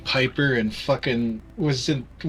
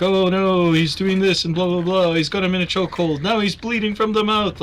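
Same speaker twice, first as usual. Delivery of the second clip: raised-pitch play-by-play commentary, running on excitedly; mock wrestling announcer; 5 semitones higher than usual. Voice announcer covering up a real fight, mock commentary. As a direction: call it like a ringside announcer, higher than usual talk. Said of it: "the voice announcer will be like".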